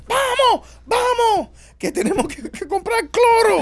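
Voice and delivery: very high, loud mock commands; bossy grandma yelling. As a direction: hustle him along as a hammed-up grandma. Acting grandma ordering him along the store. (abuela mandona gritando agudo, imitada)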